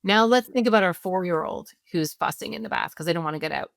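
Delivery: normal talking voice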